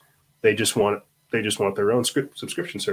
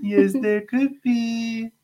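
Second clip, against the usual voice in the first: high, slow cooing to an infant; 12 semitones higher, much slower than usual. Real, not acted. Dad cooing at the baby.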